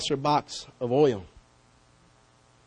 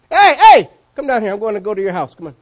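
high, loud call up into a tree; loud, high call to a man up a tree; 5 semitones higher, 11 dB louder than usual. Acted. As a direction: shout up to Zacchaeus, then settle. Jesus calling up into the tree.

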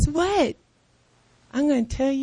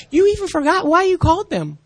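usual speaking voice

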